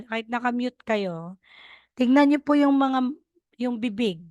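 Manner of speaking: normal talking voice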